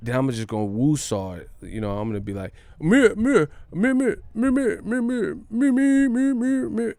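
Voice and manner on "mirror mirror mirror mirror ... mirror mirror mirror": high-pitched runaway stammering; bad stutter. Imagining himself stuttering that bad, high frantic stammer. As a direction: get stuck on one syllable, over and over. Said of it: "If I start stuttering that bad".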